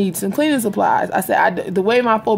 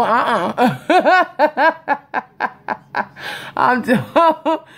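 words broken by laughter; real laughter, high; laughing; 4 semitones higher, much slower than usual. Real, not acted. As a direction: talk through the giggles. Laughing over bug story.

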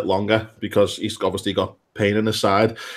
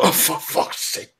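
exasperated groan; 5 semitones higher, 4 dB quieter, slower than usual. Retold or imitated. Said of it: "open that box and go".

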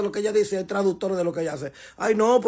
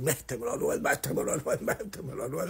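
hammed-up imitation of a woman begging, dropping to a hush; mock moaning plea; 4 semitones lower, 8 dB quieter than usual. Host mocks the singer begging [name], mock moaning plea.

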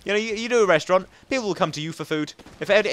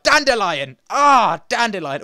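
loud, higher fake swear; mock curse; 4 semitones higher, 6 dB louder, slower than usual. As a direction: curse with a flower name. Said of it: "Or like".

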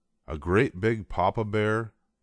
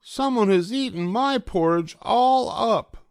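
very high, louder wee bear voice; tiny bear upset; high cub voice crying out; baby bear, sadly; 5 dB louder than usual. Acted. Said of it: "said the baby bear sadly".

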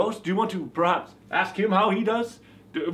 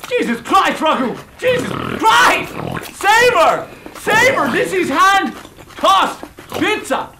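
high, loud yelling, last line slow; furious shout; 11 semitones higher, 7 dB louder than usual. Acted. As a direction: bellow it at him, much higher. Outburst at Ragu over pizza.

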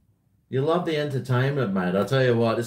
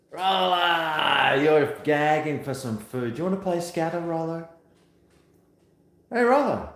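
calling the dog; 5 semitones higher than usual. Real, not acted.

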